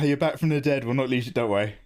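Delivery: ordinary talk, nothing marked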